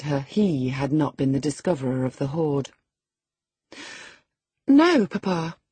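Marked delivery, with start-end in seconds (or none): 4.7-5.6 a higher, louder, startled "No, Papa"; gasped denial. A narrator's reading. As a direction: caught off guard, denying quickly. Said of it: "she gasped".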